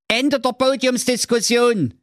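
very high, insistent cry; imperious call. A narrator's reading. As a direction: cut the debate off in a high hero voice. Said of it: "rief der Schwerthelm". (herrischer Ausruf, sehr hohe Stimme)

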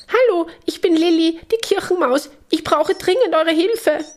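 very high little mouse voice, agitated; Lilli. A narrator's reading. Agitated church mouse begging the friends for help, very high urgent voice. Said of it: "eine aufgeregte kleine Maus".

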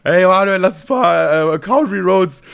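put-on loud slurred drunk request; drunk slurring. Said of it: "lallt mich so an".

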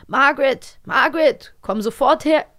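a hint of an outraged lord calling his daughter; stern father calling. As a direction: summon her sharply across the house. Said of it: "Was sagt er? A".

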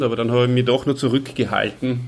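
normal talking voice

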